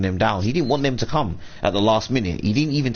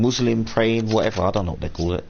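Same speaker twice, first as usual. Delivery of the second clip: ordinary talk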